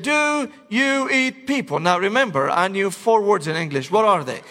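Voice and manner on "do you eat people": high, slow exaggerated roommate voice. Tall roommate asking the new student slowly. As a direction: talk slowly as if to a foreigner.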